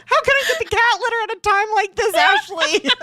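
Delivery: high, tearful laughing plea; laughing protest. Retold or imitated. Retelling her protest to Ashley, laughing and tearful. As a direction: wail it through laughter. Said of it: "I'm like".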